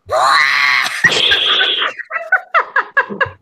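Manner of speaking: shrill screeching laugh; horrifying screech. Real, not acted. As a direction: let out a wild screech. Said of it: "That is horrifying".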